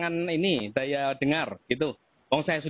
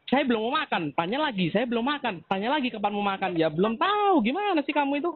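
mocking mimicry; 7 semitones higher than usual. Real, not acted. Debtor mocks repeated questions.

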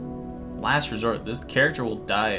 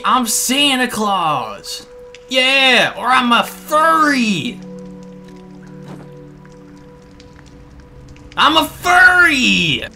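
excited proclamation; 7 semitones higher, slower than usual. Real, not acted.

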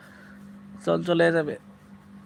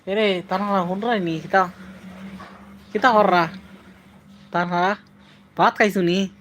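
Sylheti dialect. Shown as a demonstration. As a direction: call out to Tanha in broad Sylheti. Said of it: "Sylheti ভাষায় কথা বলতে". (সিলেটি টানে ডাকা)

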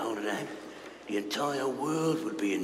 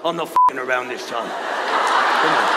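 loud, higher panicked threats; threatening shout; 4 semitones higher, 7 dB louder than usual. Acted. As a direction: bark orders like a cornered villain. Villain unmasked, threatens.

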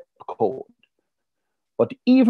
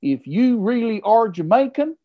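higher, brash American heckler voice; doubting heckler; 6 semitones higher than usual. Retold or imitated. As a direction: taunt him to prove himself. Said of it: "a man jumps up and says".

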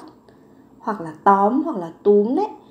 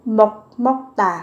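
slow model word; 4 dB louder, much slower than usual. Shown as a demonstration. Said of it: "các bạn có từ mokta".

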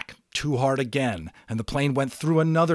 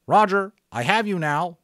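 higher, louder clipped radio procedure voice; radio operator; 8 semitones higher, 7 dB louder than usual. Acted. Radio operator telling an unknown caller to release the switch, brisk. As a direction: sound like a pilot on the net.

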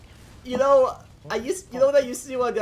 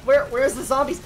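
ordinary delivery

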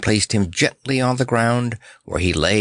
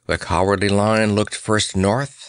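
plain reading voice

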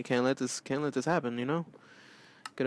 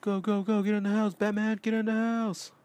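high, urgent hurry-up cries; urgent yelling; 9 semitones higher than usual. Acted. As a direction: hurry Batman into the house.

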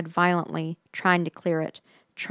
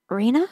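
name called on a high rising tone; questioning call; 6 semitones higher, 4 dB louder than usual. A narrator's reading. Friend checks on her silent companion, questioning. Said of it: "Steffi said again".

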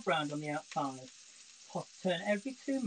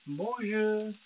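playful French; 4 semitones higher, slower than usual. Pretend. Breaks into French phrases.

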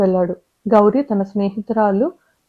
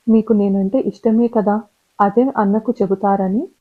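usual speaking voice

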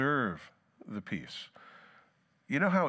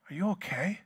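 higher, prying concerned voice; mock concern; 8 semitones higher than usual. Asker needling a touchy person with mock concern. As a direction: needle them with fake worry.

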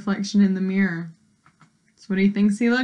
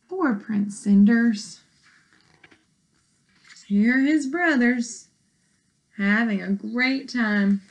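plain reading voice

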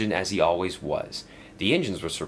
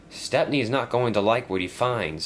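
ordinary delivery, nothing marked

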